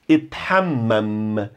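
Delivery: ethammam slow, with long held Ms; leaning on the Ms. Shown as a demonstration. Teacher demonstrating ethammam, Ms held long. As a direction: hold the Ms in ethammam, slowly. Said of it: "Valorize o som dos M's".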